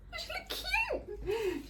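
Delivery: high, squeaky coo with a laugh; delighted cooing. Real, not acted. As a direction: coo over it like something adorable. Cooing over a newly potted plant.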